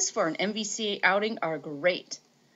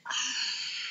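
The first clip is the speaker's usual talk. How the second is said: excited gasp; 8 dB quieter than usual. Pretend. Excited gasp over Tinker Bell.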